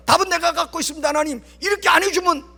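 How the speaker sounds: high, quick bargaining threat; mock threatening. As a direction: lean on God with an acted veiled threat. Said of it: "하나님 앞에 심지어 이렇게 협박하잖아요".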